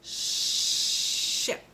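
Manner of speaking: ship with a long unvoiced sh; careful ship. Shown as a demonstration. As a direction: stretch the sh in ship. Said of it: "here's our next word".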